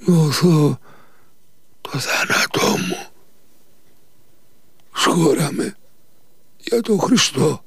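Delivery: high and strained, with a faint whispered middle; whimpering voice. Acted. Nikita tells his master he feels death coming, whimpering. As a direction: whimper it out, barely alive. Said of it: "με κλαψιάρικη φωνή".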